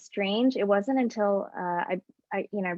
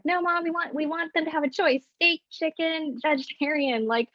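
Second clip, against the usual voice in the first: retold protest, pitched up high; pleading her case in a raised voice; arguing with mom; 7 semitones higher than usual. Daughter pushing back at mom over wedding menu, mock pleading.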